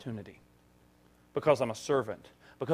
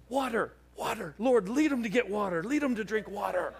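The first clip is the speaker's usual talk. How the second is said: high, pleading mock prayer; frantic silent prayer; 8 semitones higher than usual. Preacher praying the man picks water, high and pleading. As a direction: beg God under your breath, hammed up.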